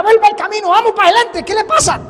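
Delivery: loud, high-pitched scolding of an animal; acted scolding. Balaam to the donkey as he beats it, loud scolding. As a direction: order it along impatiently.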